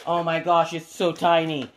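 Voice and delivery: ordinary delivery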